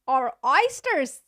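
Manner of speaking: slow, loud, bad Australian accent; hammed-up Australian accent breaking into laughter; Australian accent attempt. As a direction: try an Australian accent and crack up. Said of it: "I can't do an Australian accent".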